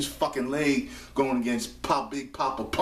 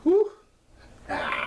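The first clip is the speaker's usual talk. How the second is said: ordinary talk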